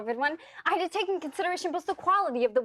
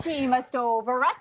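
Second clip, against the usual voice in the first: ordinary delivery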